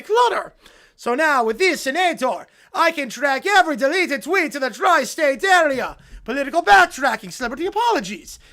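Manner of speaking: loud, hammed-up evil scientist monologue; cartoon villain. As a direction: gloat to your nemesis like a cartoon mad scientist.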